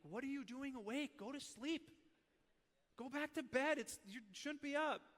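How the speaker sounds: put-on high-pitched dismay at a child out of bed; retold dad shooing his kid back to bed; exasperated parental scolding. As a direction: pitch jumps up in mock exasperation.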